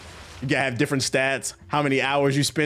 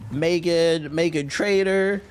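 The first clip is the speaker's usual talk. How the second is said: slow, stretched reveal of the name; drawn-out announcement; 4 semitones higher, much slower than usual. Acted. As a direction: draw the name out like a big reveal. Drawn-out announcing of his top pick.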